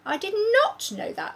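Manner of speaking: put-on a small girl admitting surprise; girl's voice.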